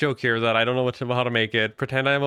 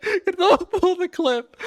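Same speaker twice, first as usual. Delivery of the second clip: slower, high laughing repeat; 3 dB louder than usual. Real, not acted. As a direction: drag it out through laughter.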